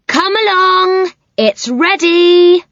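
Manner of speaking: high, slow calling voice; Miss Muffet calling out. A narrator's reading. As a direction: call out sweetly and slowly. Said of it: "called to the spider".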